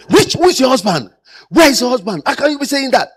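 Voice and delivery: angry outburst. Retold or imitated. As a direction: play the man losing his temper. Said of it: "You become wild".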